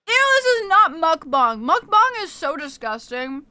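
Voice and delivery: loud, high disgusted ew. Real, not acted. Streamer disgusted at eating sounds, loud ew. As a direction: recoil in disgust.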